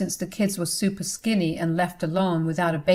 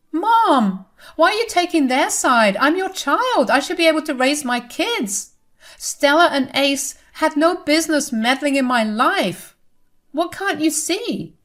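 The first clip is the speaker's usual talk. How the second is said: much higher, frantic pleading; a daughter crying out to her mother; desperate daughter; 8 semitones higher than usual. A narrator's reading. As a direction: beg Mom to take your side.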